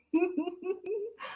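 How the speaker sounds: high breathless giggle. Real, not acted. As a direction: let a real giggle out. Laughing at tears.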